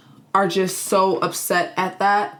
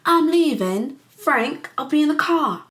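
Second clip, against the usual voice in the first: hammed-up indignation in a higher put-on voice; offended guest; 4 semitones higher than usual. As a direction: play an affronted woman, drawing out the opening. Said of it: "She felt disrespected".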